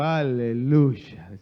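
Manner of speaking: long drawn-out, low hallelujah; congregational hallelujah. Real, not acted. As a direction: lead the crowd in a slow, loud hallelujah. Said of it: "Y conmigo". (aleluya alargado para que la iglesia lo repita)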